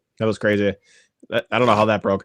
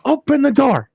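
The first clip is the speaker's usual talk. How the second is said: yelling; 13 semitones higher than usual. Retold or imitated. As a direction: shout it high through the door. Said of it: "She's like".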